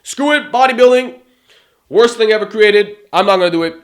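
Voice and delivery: hammed-up outburst of a lifter giving up; mock angry rant. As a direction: throw a petulant fit, loud and high. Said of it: "are you just gonna say".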